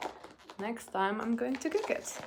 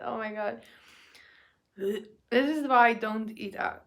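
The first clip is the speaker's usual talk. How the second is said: ordinary talk, nothing marked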